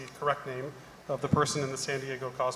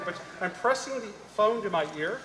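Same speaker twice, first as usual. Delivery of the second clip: normal talking voice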